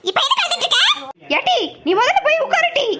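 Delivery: high-pitched impatient scold; exasperated scolding. Acted. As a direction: tell her off for crying, pitched high. Snapping at someone who keeps crying. (உயர்ந்த குரலில் எரிச்சலான அதட்டல்)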